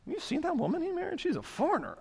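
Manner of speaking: high, quick catty gossip voice; a hammed-up gossiping busybody; gossipy Miriam. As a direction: lean in and dish the dirt about the foreign wife.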